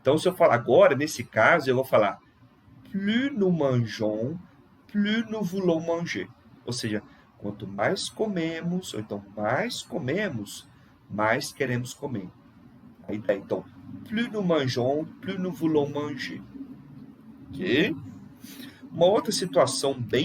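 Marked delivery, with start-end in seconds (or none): 2.9-6.3 "plus" said as "plu" before nous; higher model phrase, S of "plus" left silent. Shown as a demonstration. Teacher demonstrating consonant case, "plus nous" with silent S. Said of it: "Aí não pronuncia". 14.1-16.4 "plus" as "plu" before nous; silent S. Shown as a demonstration. Teacher repeating consonant case, "plus nous" with silent S. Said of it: "Aí não pronuncia".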